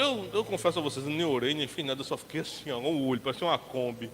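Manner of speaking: ordinary talk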